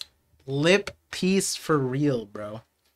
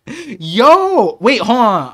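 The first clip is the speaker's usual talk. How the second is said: sudden loud yo, then a quick stop; excited outburst; 5 semitones higher, 9 dB louder, much slower than usual. Real, not acted. Reacting aloud to a manga reveal, loud and excited. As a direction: catch yourself noticing something big.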